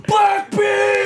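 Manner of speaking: high, loud excited shout; full-throated fan yell; imitated scream. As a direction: holler it across a packed arena. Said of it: "and he screams".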